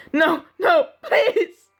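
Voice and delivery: high, loud, desperate screech; screeched plea. A narrator's reading. Jack hearing of Mark's death, screeching in grief. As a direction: plead in a high, tearful cry. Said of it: "I screeched".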